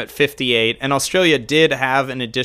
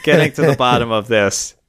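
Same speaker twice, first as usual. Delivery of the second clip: laughing. Real, not acted. Laughing through a self-mocking line.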